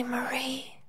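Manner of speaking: breathy whispered name. A narrator's reading. Lost child whispering Marie's name. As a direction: whisper her name from far away. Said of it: "they said".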